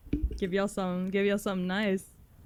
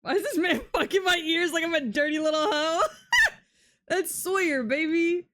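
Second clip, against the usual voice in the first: high, loud, giggly outburst; laughing excitement; 9 semitones higher, 5 dB louder than usual. Real, not acted. Streamer joking with chat about Sawyer's music, laughing loudly. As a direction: crack up while exclaiming.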